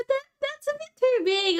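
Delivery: high mock protest. Retelling her reply to a friend, high squeaky protest.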